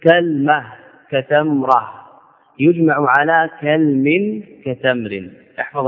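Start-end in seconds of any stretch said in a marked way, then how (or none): 0.0-0.6 كلمة as kal-mah, two syllables; كلمة closed up as KAL-mah; silent lam. Shown as a demonstration. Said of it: "بفتح الكاف ... مع سكون اللام". 3.6-4.4 كلم as kal-min, drawn out slowly; slow, two-syllable كلم: kal-min; silent lam. Shown as a demonstration. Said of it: "مع سكون اللام".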